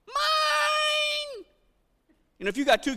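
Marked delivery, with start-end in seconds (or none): 0.1-1.4 put-on very high, drawn-out child's cry; high-pitched mock kid yell; kid shouting "mine". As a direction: imitate a possessive kid wailing from another room. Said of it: "a kid go".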